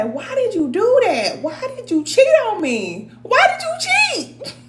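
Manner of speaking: mock accusing whine. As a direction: whine at him like a jealous partner. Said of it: "But if you say".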